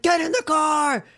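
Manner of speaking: high frantic shout. Retold or imitated. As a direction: yell it in a panic. Acting out movie hero yelling.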